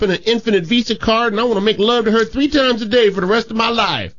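high put-on little boy voice; cheeky kid voice. Little Johnny answers his teacher in a high cheeky kid voice. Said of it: "who's been known to be mischievous".

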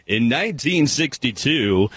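slow, booming radio announcer intro. Retold or imitated. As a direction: announce it like a Kmart radio host. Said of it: "you sounded just like an announcer".

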